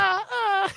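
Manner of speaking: high helium moans; helium moaning. Pretend. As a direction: moan like you're on helium. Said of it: "so it's all done helium style".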